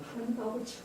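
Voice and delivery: high-pitched but held-back call; a mock yell kept small; imitated cry to God. Retelling the man's desperate prayer, imitated cry to God. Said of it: "막 소리 지르면서".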